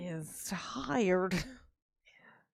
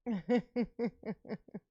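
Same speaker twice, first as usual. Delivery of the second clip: laughing; 4 dB quieter than usual. Real, not acted. Still amused by the tomatoes, laughing. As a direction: chuckle helplessly to yourself.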